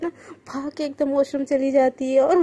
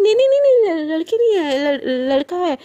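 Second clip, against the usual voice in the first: panicked denial; 3 semitones higher, 6 dB louder than usual. Acted. Panicked denial, near tears.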